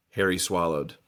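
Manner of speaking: plain reading voice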